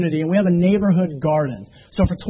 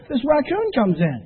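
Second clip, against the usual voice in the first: normal talking voice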